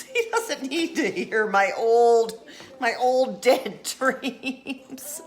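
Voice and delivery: giggling through dead dreams; laughing retake. Shown as a demonstration. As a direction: crack up on the punchline. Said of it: "corrects punchline, laughing".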